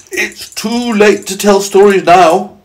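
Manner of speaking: high, loud, slow proclamation; announcing old grasshopper. Acted. Old grasshopper ending story time, high and drawn-out. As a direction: announce it to the whole company. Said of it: "the old grasshopper announced".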